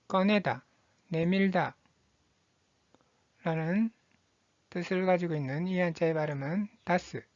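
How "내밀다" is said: ordinary delivery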